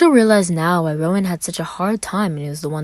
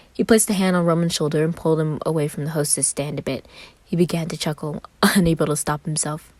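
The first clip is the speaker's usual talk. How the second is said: plain reading voice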